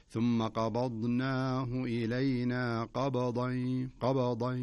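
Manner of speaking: قبضا with a bouncing ب, chanted slowly; qalqala on the ba. Shown as a demonstration. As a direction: recite slowly, echoing the ب in قبضا. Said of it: "قلقلة في الباء". (ترتيل بطيء مع قلقلة الباء في قبضا)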